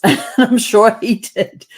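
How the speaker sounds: laughing it off, slow; chuckling through the words. Real, not acted. Laughing about taking flak from Aussies, slow laughing.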